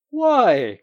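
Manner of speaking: loud, high startled why; disconcerted cry. Acted. The Doctor crying out at the refusal, disconcerted. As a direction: cry out, thrown off balance. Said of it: "cried the Doctor, disconcerted".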